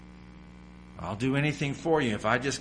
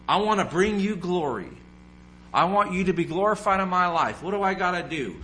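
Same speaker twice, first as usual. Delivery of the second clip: louder and higher, pleading to God; eager prayer; 6 semitones higher, 5 dB louder than usual. Pretend. A believer calling out to God, eager and loud. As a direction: cry out like a believer begging to serve.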